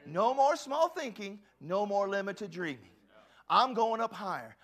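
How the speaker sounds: loud, slow and emphatic; bold declaration. Real, not acted. Preacher declaring to the congregation, loud and bold. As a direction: proclaim it to the church.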